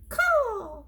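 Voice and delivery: very high cawing crow. Acted. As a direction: shriek it like a crow. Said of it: "said the Pellegrina crow".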